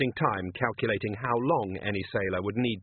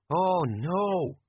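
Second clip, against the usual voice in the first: small tinny voice through a pipe; distant tinny voice; 6 semitones higher than usual. A narrator's reading. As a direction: sound far off and tinny. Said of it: "A distant tinny voice said".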